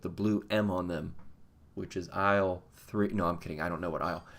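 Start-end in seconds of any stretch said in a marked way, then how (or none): none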